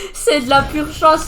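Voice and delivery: a gamer laughing off lucky kills to viewers. Real, not acted. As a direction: giggle at your own luck. Laughing at own luck.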